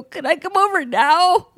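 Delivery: very high, pleading squeal; hammed-up urgent plea; desperate eagerness. Acting her tearful urgent phone plea.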